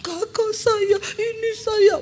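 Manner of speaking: high, breaking, sobbing words; crying. Retold or imitated. Weeping woman telling the pastor about her sister, sobbing. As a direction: weep as you name your own sister. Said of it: "Dia mulai cerita".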